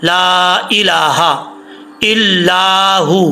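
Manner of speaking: इलाहा with the final हा kept short, chanted slowly. Shown as a demonstration. Teacher showing how short the हा in इलाहा should be, slow chant. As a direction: keep the हा in इलाहा short and clean. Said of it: "बस इतना पढ़ेंगे इसका sound".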